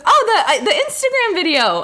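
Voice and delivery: high, loud retold realization; light up as you place the video; excited recognition. Acting the man's recognition.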